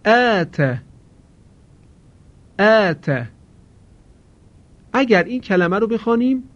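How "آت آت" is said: آت with a thin, light long آ; correct آت. Shown as a demonstration. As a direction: say آت with the vowel kept light and narrow. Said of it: "خوب توجه کنید".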